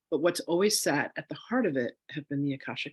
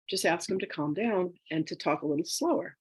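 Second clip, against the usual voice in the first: normal talking voice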